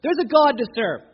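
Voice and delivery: loud, high, defiant proclamation; Joshua's bold declaration. Retold or imitated. Joshua telling the people, loud and defiant. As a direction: throw it out like a challenge to the people. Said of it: "This is like Joshua".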